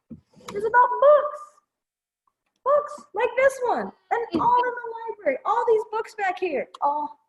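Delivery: bright excited. Acted. Storytime excitement.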